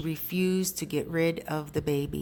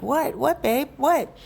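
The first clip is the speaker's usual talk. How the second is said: alarmed what; 8 semitones higher, 4 dB louder, slower than usual. Retold or imitated. Boyfriend sees her tears.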